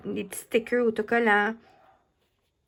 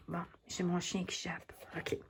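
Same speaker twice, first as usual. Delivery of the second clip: whispering; 4 semitones lower, 12 dB quieter than usual. Real, not acted. Aside while dog barks.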